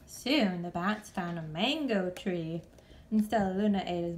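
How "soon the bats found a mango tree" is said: plain reading voice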